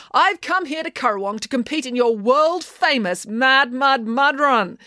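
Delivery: high-pitched speech to a crowd, slowing at the end; rousing declaration. A narrator's reading. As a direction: announce it grandly to the crowd. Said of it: "declared Maya".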